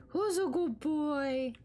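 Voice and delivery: high, slow cooing praise; baby talk to a dog. Pretend. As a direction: coo at the puppy.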